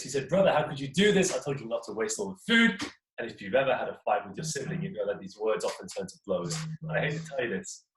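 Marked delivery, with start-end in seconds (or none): 0.3-2.8 louder, higher furious rebuke; furious brother. A narrator's reading. Furious brother scolding his greedy brother for eating everything. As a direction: scold him hard for the waste. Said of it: "True is furious".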